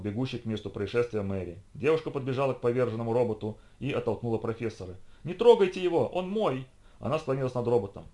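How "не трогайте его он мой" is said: high, possessive outburst; protective cry. A narrator's reading. Girl shielding the fallen robot, protective cry. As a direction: fend them off, pitched right up.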